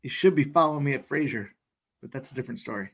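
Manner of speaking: excited race-calling patter. Acted. Host calling the race for stream viewers, excited. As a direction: call the horses like a track announcer. Calls horse race live.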